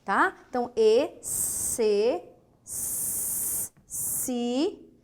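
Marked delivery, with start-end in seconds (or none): 2.7-3.7 S, S said as a long voiceless hiss; hissed S sound. Shown as a demonstration. Teacher showing the S sound to students. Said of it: "um som de S".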